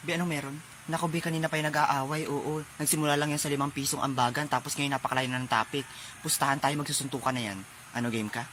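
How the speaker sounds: low, soft gossiping aside; hushed aside. Acted. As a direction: murmur a side bet to a friend. Bystanders betting aside during quarrel.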